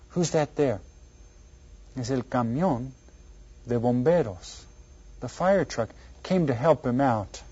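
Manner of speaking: ordinary delivery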